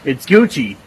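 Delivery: usual speaking voice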